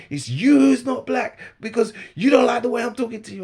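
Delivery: high, very loud accusation; imitating shouting. Heckler accuses him over how they talk, shouting. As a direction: yell it as the indignant heckler. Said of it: "Why are you shouting for".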